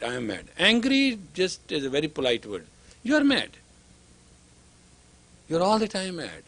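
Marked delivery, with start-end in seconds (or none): 0.0-0.5 softer, lower, plainly owning it; a calm confession of anger; quiet honest admission. Shown as a demonstration. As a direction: admit it simply, no heat. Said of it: "But you say it right".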